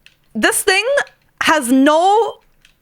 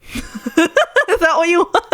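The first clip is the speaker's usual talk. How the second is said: laughing; 5 semitones higher, much slower than usual. Real, not acted. After the deep voice demo.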